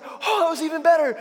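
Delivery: put-on high, loud, excited shout of a boy; thrilled kid. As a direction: shout it like a kid at the bottom of a hill. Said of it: "He's like".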